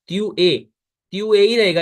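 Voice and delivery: त्यू ए with no t sound between; say tu es right. Shown as a demonstration. Teacher drills त्यू ए aloud for Hindi-speaking students. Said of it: "इसमें टी नहीं लगाएंगे हम".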